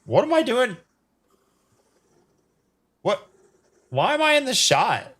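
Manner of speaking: startled. Real, not acted. Startled at own camera view.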